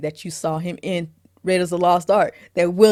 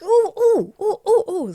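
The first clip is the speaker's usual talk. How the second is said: string of high, louder oohs; high mimicked oohs; 14 semitones higher, 6 dB louder than usual. [name] watching the punching, high mimicked oohs. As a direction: imitate wincing at each punch.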